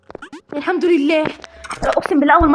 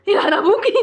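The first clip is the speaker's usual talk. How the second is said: burst of laughter; 10 semitones higher, 4 dB louder than usual. Real, not acted. Laughs at the awful level.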